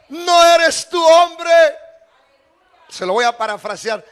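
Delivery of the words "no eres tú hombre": slow, loud challenge on hombre; David rebuking Abner, louder the second time; louder reproach. Acted. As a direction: throw hombre at him as a rebuke. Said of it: "Y le dijo a David".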